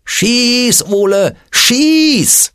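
high-pitched urging cry; grandpa's yell. A narrator's reading. Grandpa cheers Ole on to shoot, high yell. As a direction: cry out the command high and drawn out. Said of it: "hörte er seinen Opa schreien".

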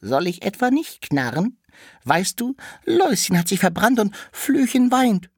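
the door explains itself to the cart in a high squeak; creaky little door. Acted.

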